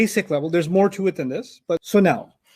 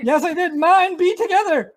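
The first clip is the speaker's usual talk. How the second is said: hammed-up quarrel; 11 semitones higher than usual. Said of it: "when you have an argument".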